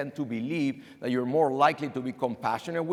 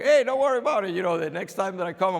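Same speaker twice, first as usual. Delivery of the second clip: breezy lifeline joke; 7 semitones higher, 7 dB louder, faster than usual. Acted. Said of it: "throw here a, a lifeline".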